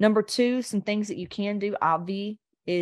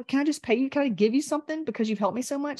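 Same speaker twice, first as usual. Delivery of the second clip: higher-pitched acted plea; pleading gratitude; 7 semitones higher, faster than usual. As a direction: act out an overwhelmed, grateful offer to pay. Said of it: "'Cause I'm like".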